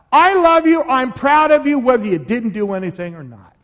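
"I love you" belted out high and loud; loud declaration. Acted. Pastor voicing a father's love to his child, loud and high. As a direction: proclaim "I love you" at the top of your voice.

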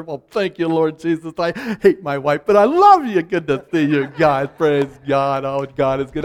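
overly sweet churchy greeting; mock-pious handshake at church; pious churchgoer. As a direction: lay on the holy charm. Mock hypocrite praising God.

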